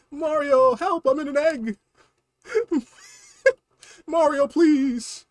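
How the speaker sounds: high, pleading little-brother voice with laughs; whiny Luigi. Acted. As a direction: squeal for Mario's help. Luigi voice begging Mario.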